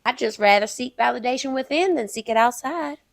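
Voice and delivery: put-on Southern belle accent. Southern belle finishes her coffin lesson, emphatic accent. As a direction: drive the moral home with Southern sass. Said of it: "The Southern Belle herself".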